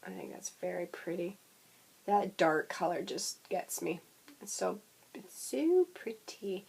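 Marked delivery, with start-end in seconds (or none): 4.4-6.7 hushed whisper of admiration. Real, not acted. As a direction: whisper it in awe.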